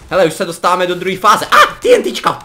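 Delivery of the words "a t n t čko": much higher, louder startled cry; alarmed shout. Real, not acted. Gamer spots TNT and shrieks a warning to teammates.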